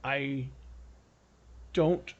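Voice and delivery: slow, stunned halting delivery. Acted. Lord stops as the skiff sails off, halting. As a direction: break it up with long pauses, slow and stopped. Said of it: "he kinda stops".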